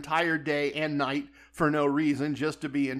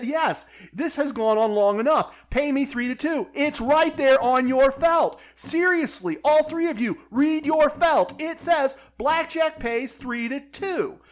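higher, louder, slowing on read your felt; tipsy gambler demanding his payout from staff, higher and louder; heated demand; 7 semitones higher, 4 dB louder than usual. Retold or imitated. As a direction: demand the payout, rising anger. Escalating argument with casino staff.

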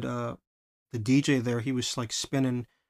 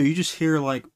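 ordinary talk, nothing marked

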